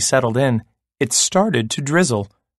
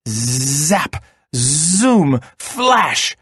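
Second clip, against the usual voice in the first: dramatized zap and zoom, climbing in pitch; lightning sound words; 2 semitones higher, much slower than usual. A narrator's reading. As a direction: make each word a streak of lightning. Storyteller voicing lightning sparks.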